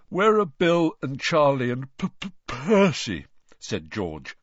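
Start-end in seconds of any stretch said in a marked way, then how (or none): 0.1-3.3 words stretched by a huge yawn. A narrator's reading. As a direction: yawn through the question, slowly. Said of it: "failing to stifle a huge yawn".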